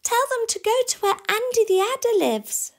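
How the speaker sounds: high, loud shouted instruction; urgent call. Acted. Sam sends the warbler to warn others, urgent shout. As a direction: call out orders over the danger. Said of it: "called Sam".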